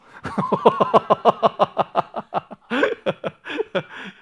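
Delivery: long run of loud rhythmic laughs; hearty laughter. Real, not acted. Joking with the audience about accessories, laughing loudly.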